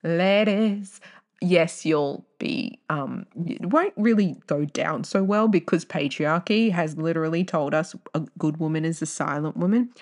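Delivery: normal talking voice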